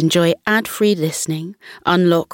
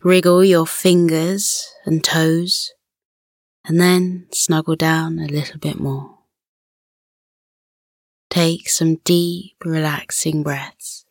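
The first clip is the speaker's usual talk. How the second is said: guided relaxation. Acted. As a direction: gently settle a child to sleep.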